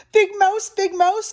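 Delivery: high-pitched small mouse call; little mouse calling. A narrator's reading. Little Mouse calling Big Mouse at night, high call. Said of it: "called Little Mouse".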